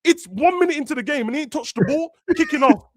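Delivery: high, loud exasperation; exasperated. Real, not acted. To fans crashing out, high exasperated protest. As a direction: throw it up high in disbelief.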